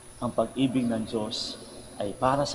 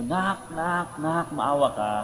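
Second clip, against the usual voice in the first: higher-pitched repeated plea, lightly acted; imitated pleading mother; 4 semitones higher, slower than usual. Mother begs child to have mercy, lightly imitated plea. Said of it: "lumabas yung nanay".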